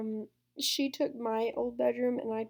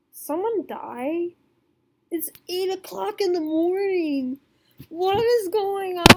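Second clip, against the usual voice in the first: higher, louder, rising alarm; upset outburst; 6 semitones higher, 5 dB louder than usual. Retold or imitated. Woken at eight by her mom, upset and rising to a shout. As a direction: demand it, outraged at being woken. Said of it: "I was so upset".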